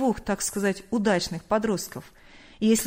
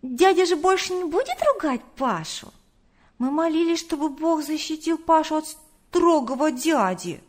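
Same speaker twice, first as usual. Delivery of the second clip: put-on high-pitched child voice, eager; little boy; 6 semitones higher, 3 dB louder than usual. A narrator's reading. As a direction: pipe it up like a small child telling Dad. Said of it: "тут же сообщил он". (высокий голос маленького мальчика)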